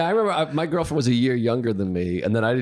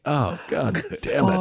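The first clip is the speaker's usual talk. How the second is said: slow mock dismay; 6 semitones higher, slower than usual. Inner dismay at her crying.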